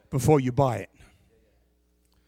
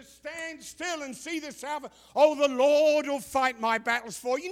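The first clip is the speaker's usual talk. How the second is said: mocking churchgoer; 10 semitones higher than usual. Preacher mimicking believers quoting scripture, high and mocking. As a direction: parody the pious cliché. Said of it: "Everybody remembers the".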